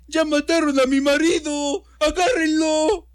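very high, sustained wailing call; woman's cry. Acted. Wife to the crowd after her husband's killing, high cry. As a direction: cry out high for someone to stop him. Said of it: "otro grito que decía".

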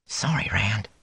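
soft, muttered apology. A narrator's reading. Matt apologises to his friend, muttering softly. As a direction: mumble it under your breath. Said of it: "Matt muttered".

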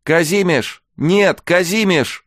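very high, loud, drawn-out wail; wife's cry. A narrator's reading. Wife pleading with her husband, crying out. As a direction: cry out desperately to hold him back. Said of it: "закричала жена".

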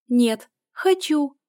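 high, loud, drawn-out protest; stubborn loud outburst; crying out. A narrator's reading. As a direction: shout it like an insistent child. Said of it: "наши с дочерью выкрики смешались".

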